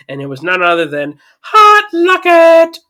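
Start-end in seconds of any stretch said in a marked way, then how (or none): 1.4-2.8 hyped announcement. Real, not acted. Announcing the star receiver.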